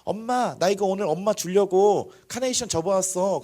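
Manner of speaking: put-on high, slow kid voice; little child. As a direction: imitate an eager child presenting a gift to mom. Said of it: "애기가 막 카네이션 같은 거".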